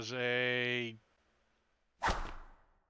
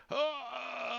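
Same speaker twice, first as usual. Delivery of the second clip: high, drawn-out startled "oh"; startled enemy; 11 semitones higher than usual. Acted. Game master voices the guy facing a close shot, mock startled cry. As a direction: play a mock gasp of alarm, pitched up. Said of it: "The guy looks at you like".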